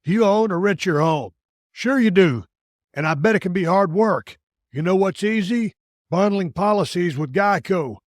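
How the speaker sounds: punchy, evenly paced sponsor read; scripted radio commercial delivery. Real, not acted. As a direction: sell it line by line, crisply separated. Reads a sponsor ad.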